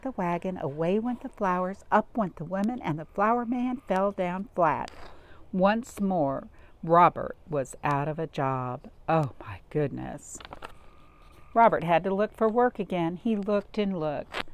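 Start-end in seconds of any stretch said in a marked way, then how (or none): none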